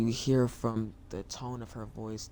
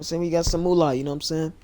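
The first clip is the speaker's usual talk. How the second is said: excited; 5 semitones higher, 8 dB louder, much faster than usual. Real, not acted. Gloating over the fare.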